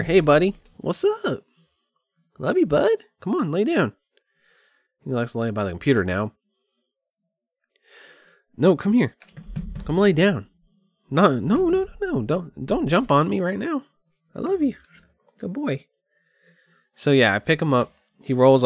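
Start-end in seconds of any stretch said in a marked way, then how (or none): 0.0-3.9 high, fond voice for a cat; affectionate pet talk. Real, not acted. Owner greets his buddy the cat, high affectionate pet talk. 8.6-15.9 high-pitched cooed commands to a pet; affectionate pet talk. Real, not acted. Owner coaxes his cat to lie down, high pet voice.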